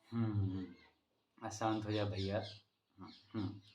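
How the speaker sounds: normal talking voice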